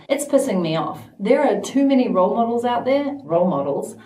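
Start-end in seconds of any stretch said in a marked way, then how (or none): none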